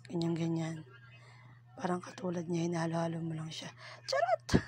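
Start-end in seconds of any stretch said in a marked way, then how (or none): 4.0-4.6 a sudden high squeal for a cheeky joke; cheeky high "Charot!" after a joke; playful joking squeal. Real, not acted. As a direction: squeal it out as a tease. Teasing joke exclamation.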